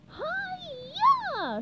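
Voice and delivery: high, loud karate yell; karate shout. Acted. SpongeBob chopping the knight's weapon, high loud yell. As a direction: snap out a high battle cry. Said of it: "shouted SpongeBob".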